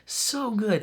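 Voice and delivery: high, delighted gush about the writing. Real, not acted. Fan gushing to viewers about the writing, excited. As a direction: rave like a thrilled fan.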